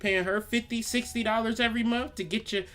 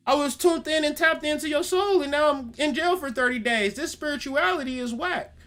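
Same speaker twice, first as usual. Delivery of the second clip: mock whiny complaint; 7 semitones higher than usual. Mocking listener's complaint.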